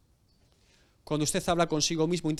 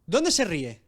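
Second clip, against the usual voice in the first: usual speaking voice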